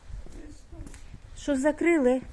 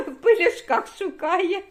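laughing; 6 semitones higher than usual. Real, not acted. Laughing at pet.

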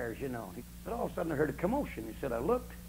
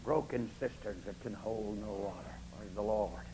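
hushed drop; 7 semitones lower, 8 dB quieter than usual. Acted. Drops after the shout.

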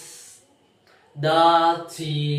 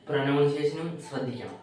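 normal talking voice

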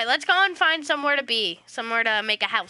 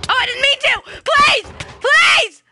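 loud, high-pitched begging shout; panicked pleading; 10 dB louder, slower than usual. Pretend. Player begs the iron golem to spare them, panicked pleading. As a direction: cry out for mercy, very high.

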